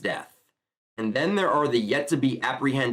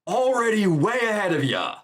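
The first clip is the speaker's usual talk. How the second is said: higher-pitched mock yell; Bakugo yelling; 8 semitones higher than usual. Bakugo shouting back at All Might, mock yell. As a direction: imitate a brash yell without full volume. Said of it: "we got Bakugo yelling at the top of his lungs".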